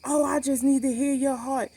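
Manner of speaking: high sing-song mock excuse; scornful imitation of a nurse.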